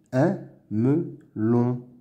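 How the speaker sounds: "Un melon" slowly as "un", "me", "lon"; syllable by syllable. Shown as a demonstration. Teacher sounding out words to read, "Un melon" in slow pieces. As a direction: pause after each syllable of "Un melon". Reading drill, split then blended.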